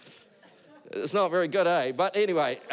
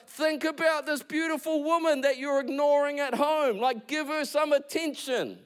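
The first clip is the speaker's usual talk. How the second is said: much higher mock nagging friend; wife's friend scolding the husband about his cars; 9 semitones higher than usual. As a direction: tell him off like a bossy friend. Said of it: "And her friend's like".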